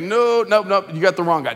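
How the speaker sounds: loud, panicked denial; frantic loud denial. Retold or imitated. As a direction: shout the denial, desperate. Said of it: "he's like".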